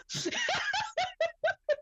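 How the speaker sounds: high burst of real laughter; a high laughing fit that breaks off. Real, not acted. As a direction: crack up, squealing with laughter.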